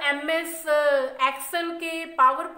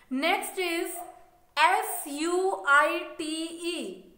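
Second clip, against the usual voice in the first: ordinary talk, nothing marked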